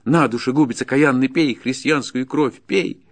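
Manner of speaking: high, loud accusation; enraged peasant's outburst; furious cursing. Acted. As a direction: curse him, climbing to a cry. Peasant curses forester.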